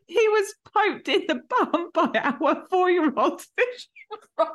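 high, squeaky laughing read-aloud; losing it completely while reading; helpless laughter. Real, not acted. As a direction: barely get the words out for laughing. Breaks down laughing while reading confession.

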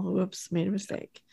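soft, sheepish aside; bashful. Acted. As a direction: own up quietly, a bit sheepishly. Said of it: "He's, like, bashful".

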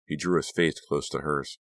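plain reading voice

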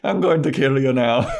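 loud, high stage-Irish threat; mock Irish accent. Vampire threatening his victim, mock Irish accent. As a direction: ham up the Irish accent through laughter. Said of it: "doesn't even sound like that".

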